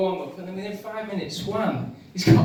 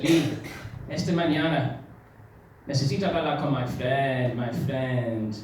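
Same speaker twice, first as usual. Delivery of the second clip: put-on Spanish accent; 5 semitones lower than usual. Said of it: "Juan said".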